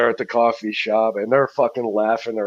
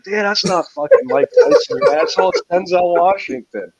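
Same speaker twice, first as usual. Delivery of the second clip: friend correcting; 8 semitones higher, 4 dB louder than usual. Retold or imitated.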